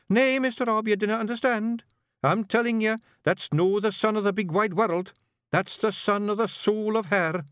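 put-on high boyish Scots, thinking hard; young Scottish pictsie bard. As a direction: ponder it like a nervous young bard. Billy insists.

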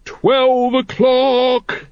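very high, long-held town crier call; ringing watchman's cry, drawn right out; bellowed. A narrator's reading. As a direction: bellow the hour slowly across the street. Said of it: "he bellowed".

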